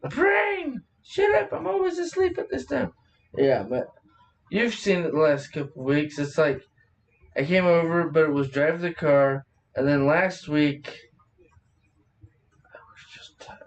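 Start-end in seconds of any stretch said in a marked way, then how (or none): 0.1-2.9 mock scolding. Scolding own brain, laughing. 12.7-13.6 whisper. Real, not acted. Whispered aside.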